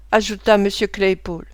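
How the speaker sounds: ordinary delivery, nothing marked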